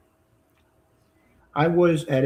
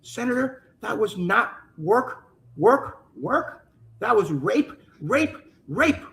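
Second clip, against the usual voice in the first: trembling voice; 7 semitones higher, slower than usual. Retold or imitated. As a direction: survivor pleading with the senator, lightly trembling. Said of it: "with her voice trembling".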